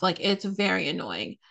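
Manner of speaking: ordinary talk, nothing marked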